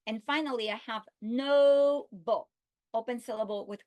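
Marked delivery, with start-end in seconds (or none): 1.2-2.5 slow demo. Shown as a demonstration. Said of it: "modeling word noble".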